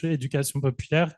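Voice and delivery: éducation populaire said the French way; French pronunciation. Real, not acted. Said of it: "or in French".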